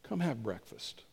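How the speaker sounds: soft, low, near-whispered invitation; gentle hushed invitation. Acted. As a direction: drop low and invite them in quietly. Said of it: "would hear Jesus whisper".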